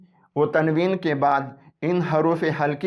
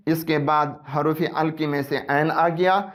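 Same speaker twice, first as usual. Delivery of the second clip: normal talking voice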